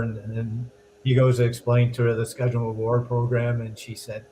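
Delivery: usual speaking voice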